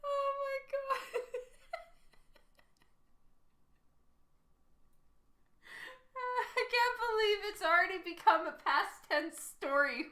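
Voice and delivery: high-pitched, breaking up with laughter; laughing hard. Real, not acted. Streamer cracking up at the chat's jokes, laughing hard. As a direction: try to talk through helpless laughter.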